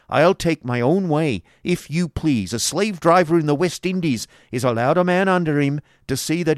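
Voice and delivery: loud, high-pitched stern declaration. A narrator's reading. Schoolmaster tells wife he'll have his own way, stern. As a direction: lay down the law forcefully. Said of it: "said Squeers sternly".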